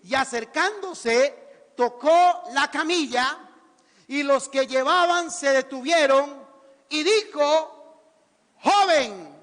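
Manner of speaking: slow, loud scripture reading; reading aloud. Real, not acted. Preacher reading the verse aloud with the church, slow and loud. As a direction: proclaim the verse slowly and loudly. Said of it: "Lean conmigo, fuerte".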